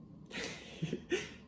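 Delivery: airy unvoiced laugh, very high; a quick silent giggle; real little laugh, almost no voice; breathless laugh. Real, not acted. Laughing while telling the story.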